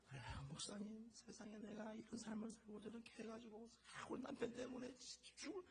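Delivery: soft, high, plaintive retold complaint; thin, hushed mock wailing; imitated lament. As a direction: voice a long-suffering wife sighing to her pastor, hushed. Retells wife's lament to pastor.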